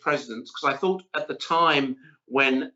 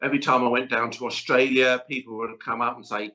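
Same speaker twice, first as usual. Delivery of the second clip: ordinary delivery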